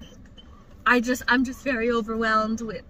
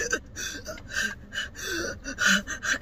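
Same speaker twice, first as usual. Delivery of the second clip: long imitated wheezing gasps; acted breathless heaving; asthma-attack gasps; 5 semitones higher, 7 dB quieter than usual. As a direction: fight for air, gasping repeatedly. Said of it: "I started having an asthma attack".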